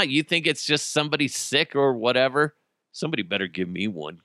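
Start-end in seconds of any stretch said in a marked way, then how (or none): none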